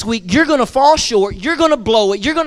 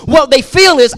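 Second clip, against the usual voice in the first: ordinary delivery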